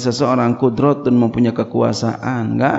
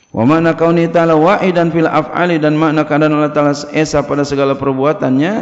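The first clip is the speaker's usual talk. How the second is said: louder, measured recitation of the Arabic text; kitab reading; 3 semitones higher, 5 dB louder than usual. Acted. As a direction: read the kitab aloud like a pesantren teacher. Reads kitab text aloud. (membaca kitab dengan lantang)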